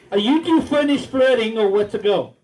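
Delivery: ordinary talk, nothing marked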